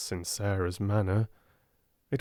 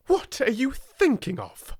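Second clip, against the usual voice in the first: much higher, sharp demand; 14 semitones higher than usual. Acted. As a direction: snap the question at her. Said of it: "she demanded".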